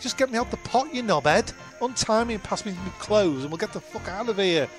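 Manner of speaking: usual speaking voice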